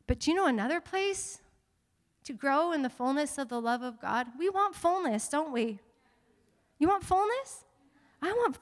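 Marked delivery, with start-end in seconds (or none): none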